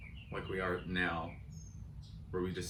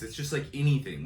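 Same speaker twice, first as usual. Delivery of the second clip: ordinary delivery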